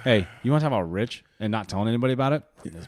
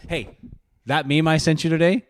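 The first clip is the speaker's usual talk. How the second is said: ordinary talk, nothing marked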